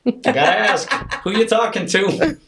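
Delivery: high, loud retold question; tentative asking. Parent carefully probing daughter's secret conversation, high-voiced and tentative. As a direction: pitch it way up, gingerly asking.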